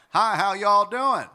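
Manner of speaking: high, loud, slow Southern twang greeting; Nashville twang. Shown as a demonstration. Coach imitates a Nashville greeting with Southern twang. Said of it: "what's called twang".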